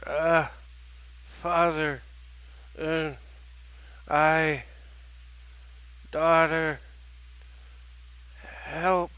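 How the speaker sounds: halting single words with long gaps; labored fragments, word by word; broken mumbling. Acted. Wounded father barely conscious, broken mumbling. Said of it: "spoke in a mumbling, broken sentence".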